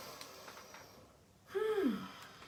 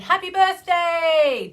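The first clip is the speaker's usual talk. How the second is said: high, loud, slow cheer; cheering a viewer's birthday across the stream; excited birthday shout; 9 semitones higher, 10 dB louder, slower than usual. Real, not acted. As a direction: call it out like a party greeting. Greets viewer on her birthday.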